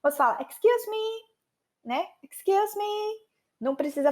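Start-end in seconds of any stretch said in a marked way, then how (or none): 0.4-1.2 passing someone. Acted. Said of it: "ultrapassar uma pessoa". 2.2-3.3 passing someone. Acted. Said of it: "ultrapassar uma pessoa".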